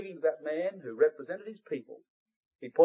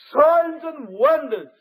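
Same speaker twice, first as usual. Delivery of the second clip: much higher and louder, very slow; climactic preaching; 9 semitones higher, 6 dB louder, much slower than usual. Real, not acted. Preacher building to a climax, high loud and very slow. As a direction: lift the phrase to a peak.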